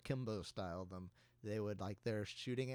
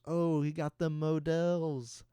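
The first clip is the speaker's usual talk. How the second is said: loud, drawn-out retold reaction; imitated gamer's exclamation; 5 semitones higher, 8 dB louder, much slower than usual. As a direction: stretch it out, higher and louder. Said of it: "and he goes".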